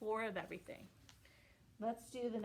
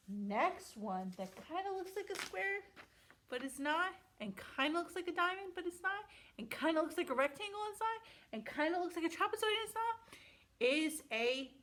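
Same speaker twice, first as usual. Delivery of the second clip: teasing buildup; 7 semitones higher than usual. Acted.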